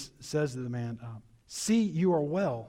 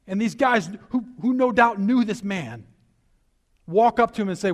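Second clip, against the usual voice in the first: louder, higher, driving sermon voice; preaching at full force; 7 semitones higher, 7 dB louder than usual. Real, not acted. Preacher stirring the congregation about the healed man, full force. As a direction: build it up and proclaim.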